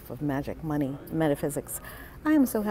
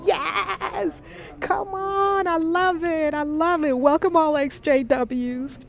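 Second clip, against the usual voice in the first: squealing cheer; 11 semitones higher, slower than usual. Real, not acted. Host welcoming her fan group in chat, squealing cheer. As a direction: cheer the crowd in.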